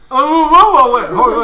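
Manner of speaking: talking through a mouthful of food; mouth full. Real, not acted. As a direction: mouth full, words muffled. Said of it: "it's rude to talk with your mouth full of cockroaches".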